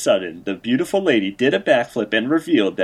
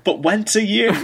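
hype announcer; 10 semitones higher than usual. Acted. Hyping the event.

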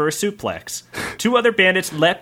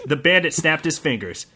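ordinary talk, nothing marked

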